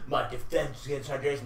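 soft, mildly beaten-down mimicry; lightly mocking a deflated, quiet delivery; imitating defeated speaker. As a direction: quote him as if bludgeoned into weariness. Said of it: "That defeated and bludgeoned".

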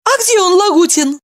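high, loud eager asking; eager question. A narrator's reading. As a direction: ask it urgently. Evdokia asking.

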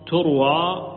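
ordinary delivery

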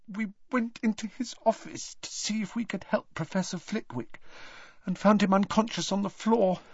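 high, distraught confession rising to a loud wail; muffled, ashamed. A narrator's reading. Hermione confessing to Harry through her fingers, ashamed and distraught. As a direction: start high and muffled, build to an anguished cry. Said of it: "her voice was muffled".

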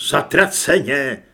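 loud exclamation of dismay; cried out. A narrator's reading. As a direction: curse out loud. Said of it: "vykřikl Carlos". (hlasitý výkřik)

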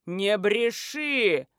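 very slow, drawn-out drone; booming man's voice. A narrator's reading. Man interrupts the lying girl, booming drawn-out rebuke. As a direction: boom out the rebuke slowly. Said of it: "прогудел мужской голос".

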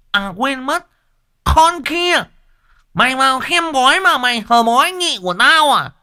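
jealous wife screaming threats with an axe; jealous furious screaming. Acted. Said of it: "sấn sổ".

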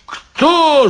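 high-pitched outraged yell. Acted. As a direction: bellow it at him. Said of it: "заорал стражник".

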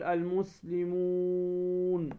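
المسلمون with the final ū held long and level; long waw madd. Shown as a demonstration. Teacher showing students where waw comes from, long waw madd on المسلمون. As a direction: draw out the و of المسلمون before closing on n. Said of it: "والواو المدية".

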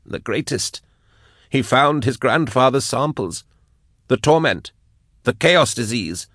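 high, strained captive voice; high-pitched compelled testimony; unwilling confession. A narrator's reading. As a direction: recite it as if forced out of you. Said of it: "fighting not to say these things".

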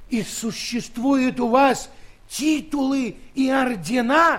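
loud, high astonished question; a king shouting in wonder; amazed shout. Acted. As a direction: burst out in disbelief.

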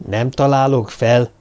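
ordinary delivery

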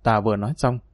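usual speaking voice

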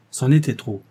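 usual speaking voice

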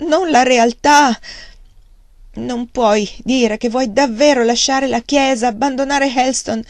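high, loud desperate plea; impassioned pleading. Acted. Margaret begs her father to stay, high loud plea. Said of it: "gridò supplicandolo con inaspettato trasporto".

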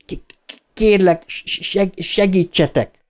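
high, broken stuttering plea; stammered plea. Acted. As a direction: beg while stuck on each first sound. Stuttering boy begs for help.

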